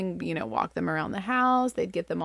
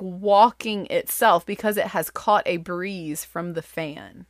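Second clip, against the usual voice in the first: ordinary talk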